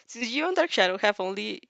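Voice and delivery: usual speaking voice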